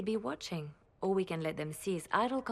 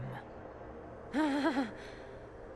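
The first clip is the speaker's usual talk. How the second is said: laugh; 5 semitones higher than usual. Acted. Greets the Inquisitor's arrival.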